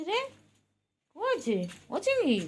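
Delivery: high, soft sing-out call; calling the pet. Real, not acted. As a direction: coax the cat over in a high voice. Calling the cat from afar. (আদুরে উঁচু গলায় ডাক)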